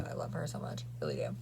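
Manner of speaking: soft unvoiced whisper; hushed fond whisper; whispered aside. Real, not acted. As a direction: breathe it like a secret. Drops to whisper about friend.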